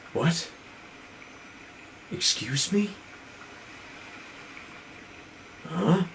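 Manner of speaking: very soft, halting questions; mock bewildered murmur; hushed bafflement. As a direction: ask it quietly, as if hearing things. Retold man hearing a voice, baffled.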